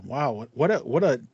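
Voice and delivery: ordinary talk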